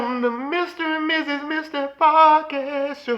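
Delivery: high, slow, loud show announcer. Acted. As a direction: announce it big.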